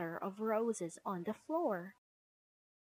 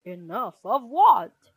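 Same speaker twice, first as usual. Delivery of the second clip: loud, puzzled question; big father-bear voice; Papa Bear; 14 dB louder than usual. Acted. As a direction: ask loudly as the father walking in. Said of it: "asked Papa Bear".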